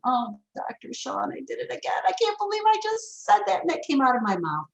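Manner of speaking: high, fretful neighbor voice; imagined apology. Pretend. Imagined neighbor Bob apologizing, high and fretful. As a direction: squeak out the embarrassed confession. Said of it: "he was saying".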